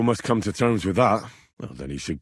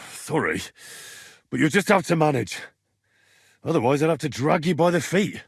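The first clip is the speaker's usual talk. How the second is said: ordinary talk, nothing marked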